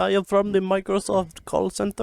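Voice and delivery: put-on high, loud Indian accent street seller; hammed-up Indian accent pitch. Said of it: "trying to do an Indian accent specifically".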